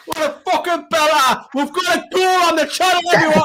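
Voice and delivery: high, excited yelling; overjoyed shouting. Real, not acted. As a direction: shout it, thrilled. Celebrating goal on stream.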